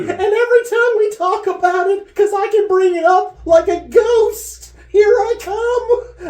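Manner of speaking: can barely get words out for laughing; crying with laughter. Real, not acted. Storyteller crying with laughter at his mom's story.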